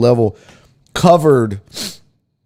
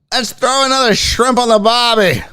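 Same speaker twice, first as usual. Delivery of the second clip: hammed-up Australian accent, pitched higher; bad Australian accent; 10 semitones higher, 3 dB louder than usual. Streamer joking to chat, mock Australian accent. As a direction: play a cheesy Aussie tourist accent. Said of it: "They could be Australian here".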